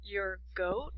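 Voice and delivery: soft, questioning repeat with a rise; puzzled echo. Acted. Listener hears someone fetching a goat, soft puzzled question. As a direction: quietly check what was just heard. Acted puzzlement at mispronounced coat.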